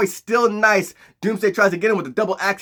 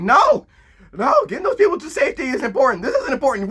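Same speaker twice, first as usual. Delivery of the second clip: high-pitched, flustered insistence; mock urgent refusal; 6 semitones higher, faster than usual. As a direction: wave off help in a high, urgent voice. Acts out Superman refusing help urgently.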